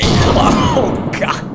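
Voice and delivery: very high, loud shriek breaking into laughter; shriek, then crack up; panicked shriek. Real, not acted. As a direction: scream tipping into hysterical laughing. Caught by enemies, breaks into laughter.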